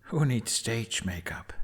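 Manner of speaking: soft whispered quip. Acted. Jack joking to himself about his face, whispered. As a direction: breathe it to yourself.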